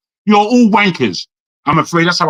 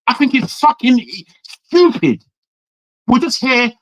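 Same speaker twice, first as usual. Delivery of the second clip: contemptuous outburst; 6 semitones higher than usual. Real, not acted. Angry verdict on the debate.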